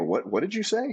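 stunned, can't believe what you just heard; a retold gasp of disbelief at being fired; shocked disbelief. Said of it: "And I go".

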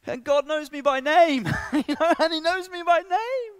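very high, loud cry of amazement; exuberant retold proclamation. Preacher voicing the saved boy at the festival, exuberant. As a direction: imitate a new convert telling everyone, thrilled.

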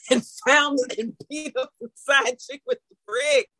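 real helpless laughter; laughing through words. Real, not acted. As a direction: try to finish the story while cracking up. Laughing while telling absurd story.

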